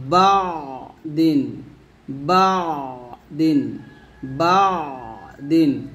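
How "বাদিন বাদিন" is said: বাদিন drawn out slowly, pressing on the ain sound; pressed ain. Shown as a demonstration. A teacher slowly modelling the pressed ain in বাদিন. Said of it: "আইনকে ধরবে তখনও চাপ দেব".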